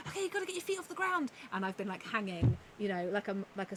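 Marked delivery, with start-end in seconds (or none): 0.1-1.3 put-on fast, high playful instruction to kids; game leader call. Leading kids in a chasing game, playful call. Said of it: "playing a game where you have to".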